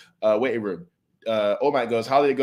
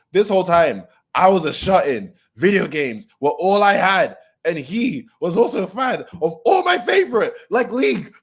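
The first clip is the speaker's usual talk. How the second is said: higher, louder character voice; hammed-up Spinner confession; voicing Spinner; 8 semitones higher, 5 dB louder than usual. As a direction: lift into a pleading high voice.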